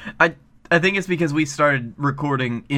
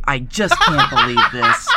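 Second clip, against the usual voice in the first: high laughing complaint; real giggly can't-believe-it; laughing disbelief; 4 dB louder than usual. Real, not acted. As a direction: laugh through the exasperation.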